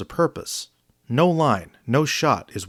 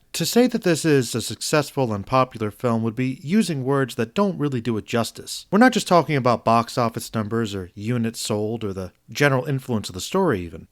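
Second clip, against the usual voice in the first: ordinary talk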